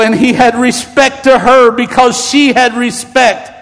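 ordinary talk, nothing marked

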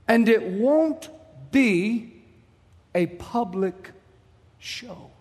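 slow, broken into weighty pieces; solemn, measured. Retold or imitated. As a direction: let each piece hang before the next. Retells the voice's solemn promise.

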